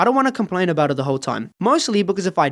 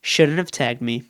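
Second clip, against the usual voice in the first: smug; 6 semitones lower, slower than usual. Acted. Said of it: "with a smug look on her face and says".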